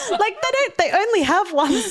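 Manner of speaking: high, loud laughter through the words; laughing. Real, not acted. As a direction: laugh as you tell the kind trick. Laughing retelling nurse's trick.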